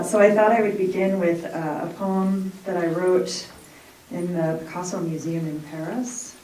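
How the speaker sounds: normal talking voice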